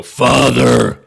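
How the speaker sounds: loud booming call from above; dopey cartoon bellow. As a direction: bellow it like a dim giant. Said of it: "you hear a booming voice above you go".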